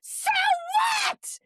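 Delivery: drawn-out heckler's yell; imitated scream, long on each word; retold scream. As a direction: re-enact screaming it across the room. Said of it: "So I scream".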